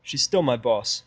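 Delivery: usual speaking voice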